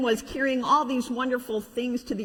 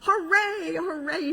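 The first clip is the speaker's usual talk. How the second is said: cheering toys; 8 semitones higher, slower than usual. Acted. Toys celebrating the climb, high cheer. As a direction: cheer like thrilled little toys. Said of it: "shouted all the little clowns".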